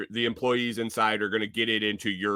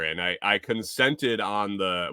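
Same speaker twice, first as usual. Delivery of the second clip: ordinary delivery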